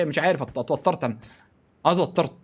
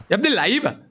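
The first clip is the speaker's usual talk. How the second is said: ordinary talk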